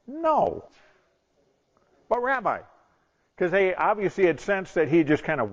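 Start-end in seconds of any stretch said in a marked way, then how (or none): 0.1-0.6 high, falling dismissive no; emphatic refusal. Acted. Rabbi refusing his disciples' question, dismissive. 2.1-2.6 high, falling plea; pleading protest. Acted. Disciples pressing their reluctant rabbi, pleading.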